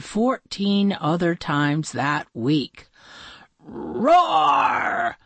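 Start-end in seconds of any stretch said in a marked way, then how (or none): 3.6-5.2 monster roar. Acted. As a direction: roar it out like a toothy beast. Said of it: "said a toothy monster".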